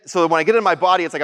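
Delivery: ordinary talk, nothing marked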